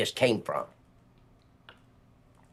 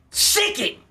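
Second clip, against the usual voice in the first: high, sharp yelled command; retold scream. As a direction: shout it like siccing a dog. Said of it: "I heard him scream".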